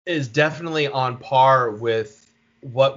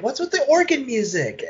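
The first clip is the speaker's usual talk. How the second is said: baffled; 10 semitones higher than usual. Retold or imitated. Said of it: "And I'm like".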